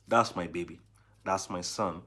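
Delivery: usual speaking voice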